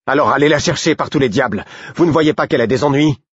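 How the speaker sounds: urgent, heated insistence; angry outburst. A narrator's reading. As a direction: demand action from a stubborn guard. Angry outburst at the blocking officer.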